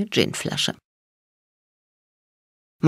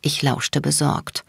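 plain reading voice

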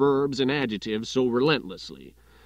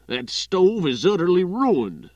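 higher, louder, huffy complaint; an editor fuming over his shattered stove; indignant outburst; 6 semitones higher, 7 dB louder than usual. Acted. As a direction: declare the loss with loud indignation. Editor after grenade wrecks stove.

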